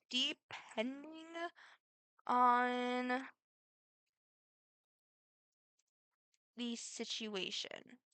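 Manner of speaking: very slow, stretched words with long pauses; drawn-out hesitation. Real, not acted. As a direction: stall on each word, leaving big gaps. Drawn-out, hesitant answer, then said normally.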